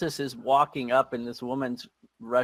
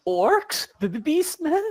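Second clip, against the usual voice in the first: high, stammering panic, much higher; scared woman stammering about beastmen; frightened stammer; 13 semitones higher, 5 dB louder than usual. Acted. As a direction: stutter out the fear, voice jumping up. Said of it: "Chatty Cathy goes".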